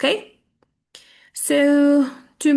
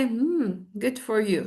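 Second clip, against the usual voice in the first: uninterested; 4 dB quieter than usual. Shown as a demonstration. Teacher shows not being happy for you, uninterested. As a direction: congratulate without caring at all. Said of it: "مش مهتمة".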